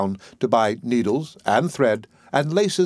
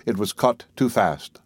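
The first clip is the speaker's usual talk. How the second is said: usual speaking voice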